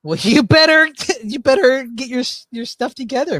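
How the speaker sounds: loud, high laughing jab; laughing retort. Real, not acted. Streamer scolding the guest, laughing. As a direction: bark it through a laugh.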